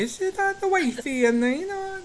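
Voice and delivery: high-pitched imitated Doric; mock Doric speaker. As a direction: put on broad Doric dialect. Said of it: "they speak Doric".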